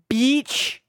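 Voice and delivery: "beach" passed off as a swear word; beach as a cheeky bitch. Shown as a demonstration. Answering a compliment with a cheeky "beach". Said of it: "I said beach, B E A C H".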